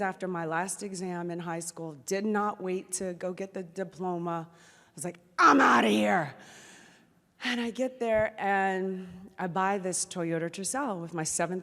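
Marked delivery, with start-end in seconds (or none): 5.4-6.3 emphatic exit line. Retold or imitated. Seventeen-year-old leaving for Los Angeles, emphatic outburst. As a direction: blurt it out, eager to be gone. Acting out her teenage escape.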